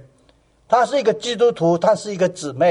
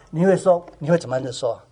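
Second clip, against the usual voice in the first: ordinary delivery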